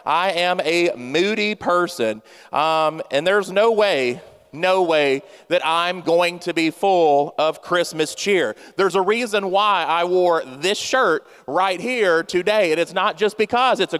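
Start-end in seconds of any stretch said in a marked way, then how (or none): none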